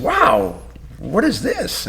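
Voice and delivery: very high-pitched, wowed reaction; retold amazement. Coach receives a handmade sign from his student, retold amazement. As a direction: relive being wowed, voice leaping up high.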